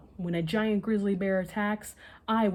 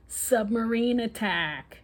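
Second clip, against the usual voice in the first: put-on slow, higher kid's battle cry; playful shout; 5 semitones higher, 4 dB louder, slower than usual. A narrator's reading. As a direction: call it out like a game. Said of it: "Justin shouts".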